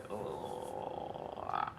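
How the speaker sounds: low drawn-out cringing "oh"; cringing groan. Retold or imitated. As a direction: let out a long, creaky groan of distaste. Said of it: "I go".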